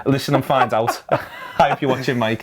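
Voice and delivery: chuckling through the words; laughing. Real, not acted. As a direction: laugh as you tease.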